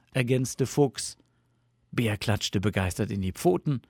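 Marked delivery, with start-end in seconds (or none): none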